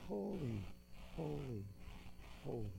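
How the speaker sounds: very soft, spaced-out "holy" three times; hushed echo of the angels' praise; hushed reverence. A narrator's reading. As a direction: let each "holy" drop away quietly. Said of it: "the angels sang".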